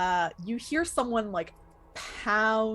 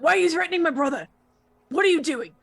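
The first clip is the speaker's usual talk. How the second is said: loud, high, fast angry demand; ferocious older brother; 8 semitones higher, 7 dB louder, much faster than usual. Acted. Teenage boy confronting his brother's questioners, ferocious. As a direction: burst in shouting to protect your brother. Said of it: "this ferocity in his eyes".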